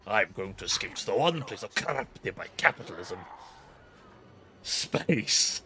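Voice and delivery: lightly voiced Tim Curry line; hint of hammy theatrical villain; bad acting. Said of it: "I think I almost delivered it as just as well".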